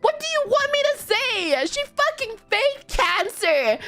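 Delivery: high-pitched, indignant protest; retold exasperated rant.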